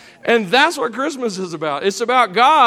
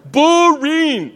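boring laughed out higher and louder; mock bored, higher; 6 semitones higher, 4 dB louder than usual. Shown as a demonstration. Preacher tells kids shepherd life, hammed-up boredom. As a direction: push the boredom over the top. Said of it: "You ready?".

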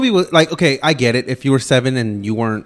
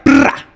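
loud, high "brah" burst as a gun; playful imitated shot; mock gunfire yell; 11 semitones higher, 6 dB louder than usual. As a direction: blast it out like firing the switch. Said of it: "and you'd be like".

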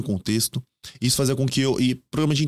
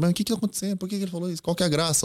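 perguntas retomadas bem mais agudas, num leve tom de confusão; perguntando confuso; 7 semitones higher, 3 dB quieter, faster than usual. Retold or imitated. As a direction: imitar a si mesmo perdido, sem entender a piada. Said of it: "eu ficava perguntando". (eu confuso diante do programa coreano, perguntando mais agudo)

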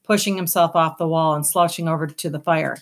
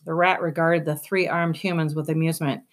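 usual speaking voice

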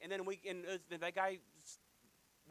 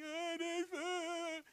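very high, long-stretched mock wail; hammed-up whine, every word dragged out; exaggerated whining; 12 semitones higher, 4 dB louder, much slower than usual. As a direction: moan it like a pitiful beggar. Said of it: "same guy up top that was whining".

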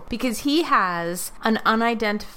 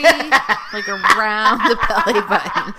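loud, through laughter; real laugh breaking into the words; 8 dB louder, slower than usual. Real, not acted. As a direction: say it loudly while cracking up. Bursts out laughing mid-joke.

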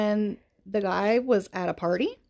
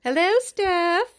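high, slow put-on hello; sing-song greeting; 9 semitones higher, 4 dB louder, much slower than usual. Cohost greets their friend Steph, slow high sing-song. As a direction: croon the name like a greeting.

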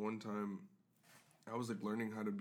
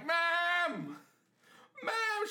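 high, loud yell of Mom; calling mom; 4 dB louder than usual. Retold or imitated. Kid yelling for mom to come wipe. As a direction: call out for mom from the next room. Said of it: "and be like".

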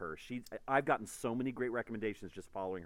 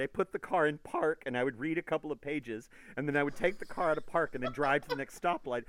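breathless fan; 6 semitones higher, 7 dB louder than usual. Retold or imitated. Fan retells her night reading.

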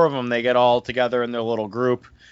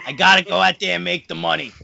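mob movie tough guy; 5 semitones higher, faster than usual. Pretend. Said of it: "main character from any mob movie".

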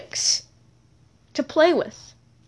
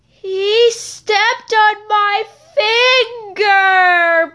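slow, high, crying whine, louder; whiny; 9 semitones higher, 5 dB louder, much slower than usual. Acted. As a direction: whine it out like a hurt child. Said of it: "Peyton said, whiny".

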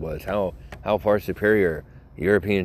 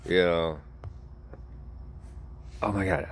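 normal talking voice

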